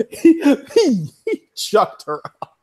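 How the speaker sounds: high voice breaking up with laughter; laughing. Real, not acted. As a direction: crack up while telling it. Laughing while retelling the scene.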